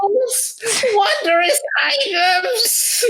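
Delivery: very high, slow, drawn-out patter; showy wizard merchant. Acted. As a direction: stretch the words like a theatrical high-voiced wizard. Wizard presents his wares.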